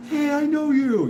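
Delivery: surprised neighbor. Retold or imitated. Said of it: "You're like".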